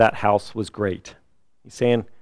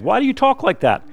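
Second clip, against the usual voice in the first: high-pitched, louder challenge; indignant rebuke; 13 semitones higher, 5 dB louder than usual. Retold or imitated. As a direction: rebuke him in a much higher, raised voice. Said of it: "he rebuked the other one".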